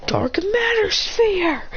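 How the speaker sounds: high, slow, drawn-out attack name; attack call. Acted. Akane joining the fight, calling her attack slowly. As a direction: call the move like an anime hero.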